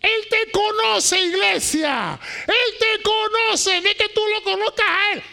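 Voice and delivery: loud, high preaching to the church; full-force preaching. Real, not acted. Pastor preaching hard to his church, loud and high. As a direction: thunder it from the pulpit.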